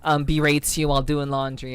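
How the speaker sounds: ordinary talk, nothing marked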